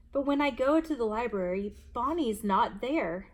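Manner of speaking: usual speaking voice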